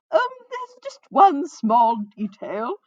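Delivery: loud, high, slow theatrical hedging; flamboyant minstrel. A narrator's reading. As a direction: break awkward news in a grand minstrel voice. Minstrel's voice, named after: Huguenot said.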